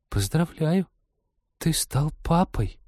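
high, slow, hollow sepulchral voice; ghost story. A narrator's reading. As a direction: announce it from beyond the grave. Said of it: "загробным голосом объявила".

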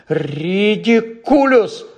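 drawn-out high squeak; squeaked spell. A narrator's reading. Scared pupil casts at looming Snape, high drawn-out squeak. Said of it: "пискнув Невіл".

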